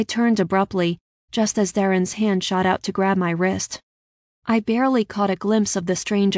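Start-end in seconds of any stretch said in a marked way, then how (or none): none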